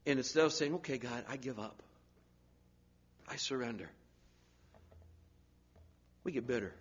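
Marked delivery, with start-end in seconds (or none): 0.8-3.9 much softer, yielding give-up; soft surrender. Retold or imitated. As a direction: let go, quietly. Surrender voiced softly.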